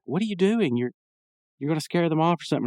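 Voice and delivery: high-pitched exasperated question; lightly imitated worried telling-off; mild scolding. Waitress telling off the guy confronting customers, mild scolding. Margaret scolds Mordecai in story.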